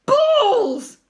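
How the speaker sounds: high, very loud, drawn-out yell; exasperated shout. A narrator's reading. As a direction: explode on the single word. Teacher's exasperated outburst.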